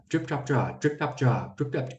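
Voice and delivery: rapid, soft tongue-twister repeats; Drip Coach's fast delivery. Retold or imitated. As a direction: rattle it off, imitating someone quick. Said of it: "He said that really fast".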